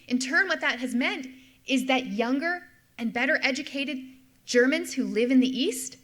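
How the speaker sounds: ordinary talk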